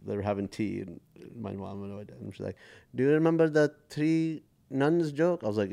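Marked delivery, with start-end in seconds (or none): 2.9-5.4 higher, slower teasing mom; lightly voiced mischievous mom; imitating mom. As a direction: mom teasing dad over tea, pitched up. Mom quoted, teasing at tea.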